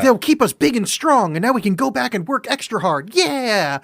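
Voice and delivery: mock rallying cry of eager workers; gung-ho team cheer. Animators rallying after the boss's ramen, gung-ho. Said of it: "this very, like, band together and get the thing done".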